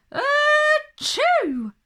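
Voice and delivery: loud high sneeze; a big dramatic achoo. A narrator's reading. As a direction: let out a huge sneeze. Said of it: "Daisy sneezed".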